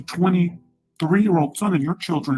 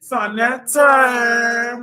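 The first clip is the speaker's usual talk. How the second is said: drawn-out call; 7 semitones higher, 6 dB louder, much slower than usual. Real, not acted. Calling out to Sonetta.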